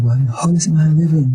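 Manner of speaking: can't believe he lives; shocked question. Retold or imitated. Shocked at no meat.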